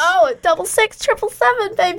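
high, hammed-up DJ plug; radio announcer. Host plugs the station's text number to listeners, high hammy announcer. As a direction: sell the number like a cheesy radio jingle.

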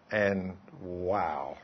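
ordinary talk

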